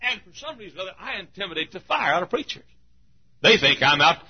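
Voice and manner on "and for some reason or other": ordinary delivery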